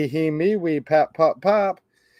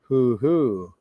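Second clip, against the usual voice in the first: owl hooting; 4 semitones lower, much slower than usual. Acted. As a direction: hoot gently like an owl. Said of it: "He can sound like an owl".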